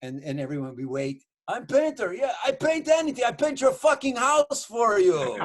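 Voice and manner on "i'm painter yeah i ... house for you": very high, bragging émigré voice; pitched way up, slowing into the big offer; Russian relative impression. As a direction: boast as the eager Russian relative. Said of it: "And he goes".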